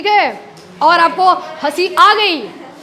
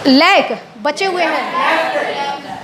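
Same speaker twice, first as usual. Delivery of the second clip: slow, stretched out लैक बचे हुए हैं; wrong usage, drawn out; 3 semitones lower, much slower than usual. Shown as a demonstration. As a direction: drag out लैक as the wrong form. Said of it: "left का इस्तेमाल करना".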